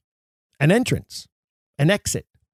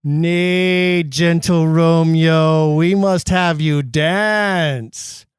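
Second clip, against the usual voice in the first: louder, slow and coaxing; Mercutio; 4 dB louder, much slower than usual. Acted. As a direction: draw the friend in, loud and drawn out.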